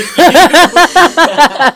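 loud, high cat hiss. Acted. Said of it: "one of the cat men hisses".

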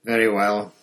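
loud, irritated giving in; exasperated. A narrator's reading. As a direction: concede through sheer annoyance. Exasperated teacher gives in.

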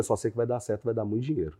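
a naive pitch, barely voiced; sheepish claim. Pitching to investors, a soft sheepish mumble. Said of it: "Eu falava, eu falei".

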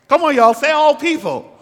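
loud, high call to the crowd; rousing call-out. Real, not acted. Preacher calling on the congregation to respond, rousing call-out. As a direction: urge them loudly to say it back.

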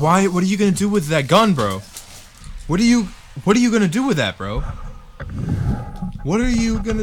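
high panicked questioning. Acted. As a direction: panic about the gun. Panicked questions about the gun.

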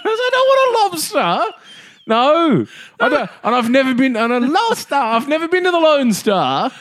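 very high, loud mock refusal; high comic insistence. Diner refusing waiter's lobster, very high voice. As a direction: squeal no through laughter. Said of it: "I said".

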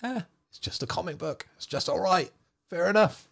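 high, breezy mock "whatever" voice; mock shrug-it-off. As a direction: toss it off like a carefree fan. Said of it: "you kind of just have to go".

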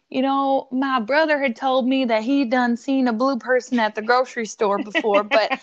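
put-on a little Southern accent; retelling in a folksy Southern accent. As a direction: put on a light Southern twang. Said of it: "in a little Southern accent".

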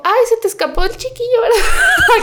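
high, laughing quoted question; mom's mock question. Mom teasing the neighbor lady about the escaped child, laughing and high. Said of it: "mi mamá le dijo".

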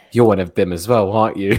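loud retold challenge; mock accusation. Speaker imitates woman accusing him of siding with family, loud challenge. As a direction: throw it out loudly as her accusation.

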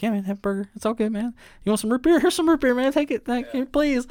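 mock scared guy. Scared roommates placating Jules, high and pleading. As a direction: babble to please the gunman. Scared guys voiced, retold.

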